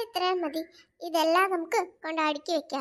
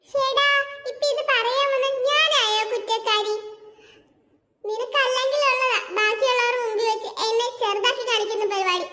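indignant outburst; 4 semitones higher than usual. Acted. Jeena indignant at being blamed.

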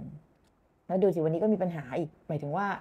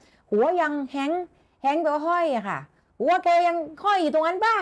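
roaring ghost; 8 semitones higher, 3 dB louder than usual. Shown as a demonstration. Acting Patrick's roar.

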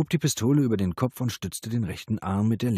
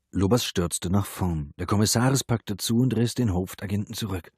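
plain reading voice

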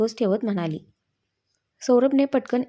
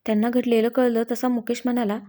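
ordinary delivery, nothing marked

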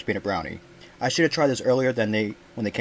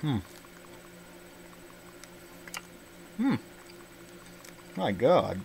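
humming while chewing; chewing candy; 9 dB quieter, slower than usual. Real, not acted. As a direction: mouth full of chocolate. Seen 0: Bite taken near -2s; closed mouth, jaw working while holding bar.

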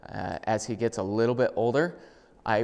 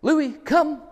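much higher and louder, crisp; recall command; 7 dB louder than usual. Shown as a demonstration. Trainer calls the dog on the long lead, loud and clear. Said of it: "Here we go".

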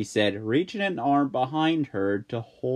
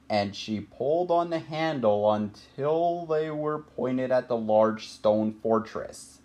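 ordinary delivery, nothing marked

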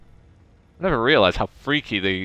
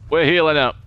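we're healing up said louder; louder repeat; 3 semitones higher, 6 dB louder than usual. Real, not acted. Gamer announcing heal to team, louder. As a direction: say healing up loud for emphasis. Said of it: "repeat louder".

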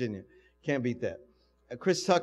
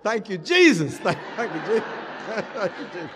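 loud, much higher burst of joy with laughs; ecstatic thanks; 6 semitones higher, 8 dB louder than usual. Retold or imitated. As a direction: shout your relief to heaven. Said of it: "I went".

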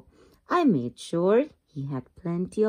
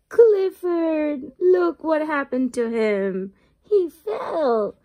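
higher, eager storybook exclaim; excited reading; 6 semitones higher, slower than usual. A narrator's reading. Reader points out the big dog's fall to children, excited. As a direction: call out his name like something just happened.

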